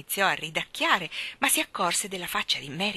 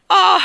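big high outburst of laughter; loud laughing fit; 10 semitones higher, 12 dB louder than usual. Acted. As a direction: throw your head back and roar. Wigg bursting into laughter.

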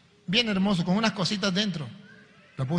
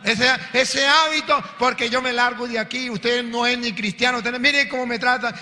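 a fed-up novice telling off her superior, raised and indignant; heated outburst; 6 semitones higher than usual. Acted. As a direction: storm off in a fit of anger, voice up high. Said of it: "la muchacha se calentó y dijo".

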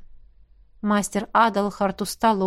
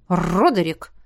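taken aback; 3 dB louder, slower than usual. Acted. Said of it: "Джемма опешила".

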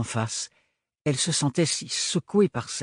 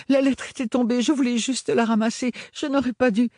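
high, rushed frightened excuses; panicked pleading; 11 semitones higher than usual. Acted. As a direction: plead in a panic, pitch climbing. Caught, girl pleads in fear.

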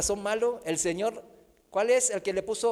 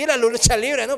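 ordinary delivery

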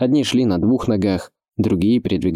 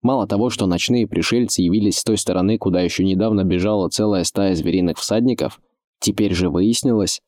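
usual speaking voice

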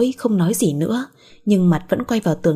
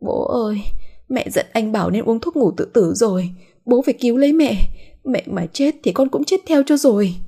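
higher-pitched, acted tearful plea to dad; tearful wailing; 4 semitones higher than usual. A narrator's reading. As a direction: plead as if bursting into tears, pitch lifted. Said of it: "òa khóc hu hu". (giọng mếu máo van nài, cao hơn)

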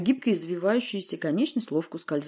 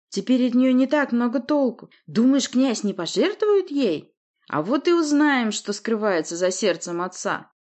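menacing villain; 6 semitones higher, 4 dB louder than usual. Acted. Villain taunts the prince.

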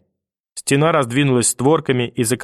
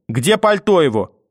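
sharp, raised demand for the coat; 6 semitones higher, 3 dB louder than usual. Acted. Grandmother demands the boy's coat, sharply. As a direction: bark the question.

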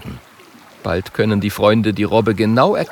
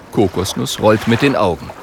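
ordinary delivery, nothing marked

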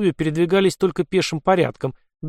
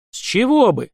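ironic smirk; 8 semitones higher than usual. A narrator's reading. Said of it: "иронично усмехнулся Лёха".

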